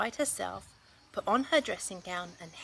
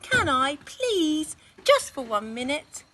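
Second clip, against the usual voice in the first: put-on high, loud child begging; pleading boy; 7 semitones higher, 8 dB louder than usual. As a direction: wheedle like a small boy. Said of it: "said Lester".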